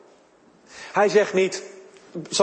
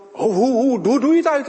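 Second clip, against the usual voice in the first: excited stammer; 7 semitones higher, 5 dB louder than usual. Real, not acted. Preacher stammers searching for words.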